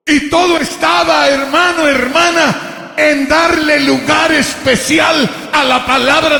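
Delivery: high, loud, driving sermon climax; preaching at full force. Real, not acted. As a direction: proclaim it to the congregation, key words stretched.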